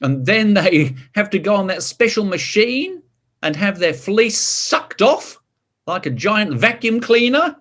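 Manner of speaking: ordinary delivery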